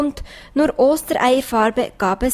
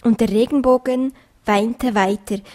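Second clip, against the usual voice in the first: ordinary delivery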